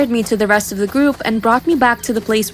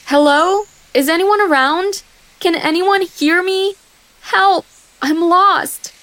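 calling for help; 7 semitones higher than usual. Acted. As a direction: call out for anyone to hear you, lost. Lost alone in forest calling.

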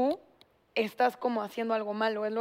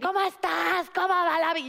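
grandma on the phone; 6 semitones higher than usual. Retold or imitated. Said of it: "es como".

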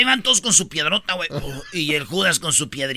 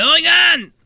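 indignant complaint; 10 semitones higher, 3 dB louder than usual. Acted. Character complains, hell is dark.

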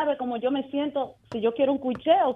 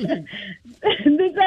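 ordinary talk, nothing marked

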